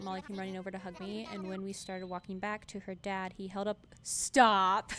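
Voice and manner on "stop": sudden very loud outburst before laughter; loud protest. Real, not acted. Streamer interrupting story of Molly's dad, loud laughing protest. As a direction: cut in loudly, then crack up.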